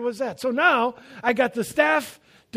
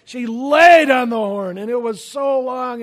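much louder, punching the outrage; loud retelling of the honk; emphatic storytelling; 3 semitones higher, 10 dB louder than usual. Retold or imitated. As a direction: blast it out like the long horn. Retelling angry honking driver.

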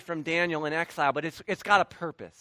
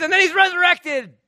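triumphant shout; 11 semitones higher, 9 dB louder than usual. Acted. Preacher to congregation, triumphant shout on the resurrection. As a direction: ring out loud and high on resurrected.

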